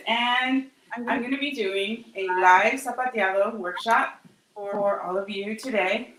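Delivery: ordinary talk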